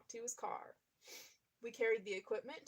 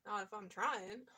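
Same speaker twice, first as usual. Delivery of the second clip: ordinary talk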